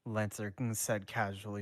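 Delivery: usual speaking voice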